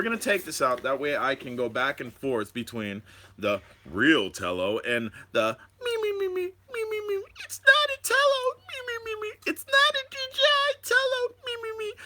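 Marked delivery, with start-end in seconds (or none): 5.8-11.9 high squeaky mock crybaby; sing-song taunting of complainers; mocking the whiny commenters, very high. Mocking whiny drone critics.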